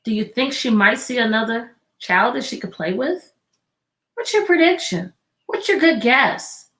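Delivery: ordinary delivery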